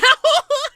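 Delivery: a sudden high burst of laughter; short high laugh. Real, not acted. Laughing at own anger.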